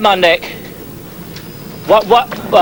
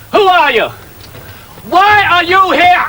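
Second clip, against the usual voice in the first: raised, sharp interrogating voice; commanding officer; 3 dB louder, slower than usual. Acted. Officer challenging an intruder on set, sharp and raised. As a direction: demand it of the intruder.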